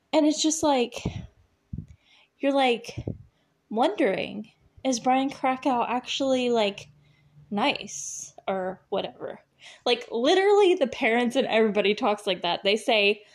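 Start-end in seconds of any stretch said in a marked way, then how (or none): 0.1-9.4 mock hesitant teenager, stopping and starting; halting teen-drama talk. Shown as a demonstration. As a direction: imitate teen-show speech with long hesitant pauses. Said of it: "talk the, the way they do. I'm gonna try it for a second".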